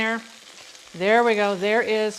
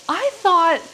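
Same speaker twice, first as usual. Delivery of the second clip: disbelief; 7 semitones higher, 5 dB louder than usual. Real, not acted. Reacts to betrayal in show.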